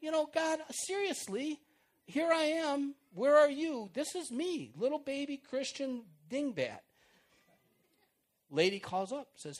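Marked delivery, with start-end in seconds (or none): 0.2-6.8 higher, louder slow whine; hammed-up complaining prayer; whining complaint. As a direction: grumble at God, mock exasperated. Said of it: "whining and complaining".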